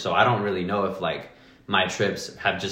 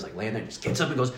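usual speaking voice

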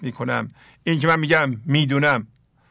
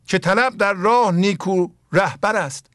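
slow, raised, chanted verse reading; measured Persian verse recitation, lingering on each word; poem recitation; 4 semitones higher, slower than usual. Acted. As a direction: intone the couplet slowly like classical poetry.

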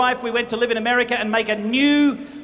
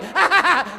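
amused laugh; 5 semitones higher, 4 dB louder than usual. Real, not acted. Preacher laughing at own act.

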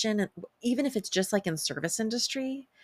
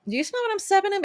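1 sassy question; 13 semitones higher, much faster than usual. Acted. Cheeky rhetorical question to viewers.

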